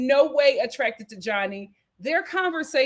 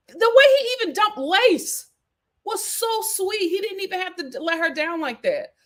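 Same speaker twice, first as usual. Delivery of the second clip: ordinary delivery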